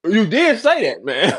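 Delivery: imitating the world telling Swamp he said it; crowd retort. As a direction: mimic everyone insisting. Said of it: "the whole world like".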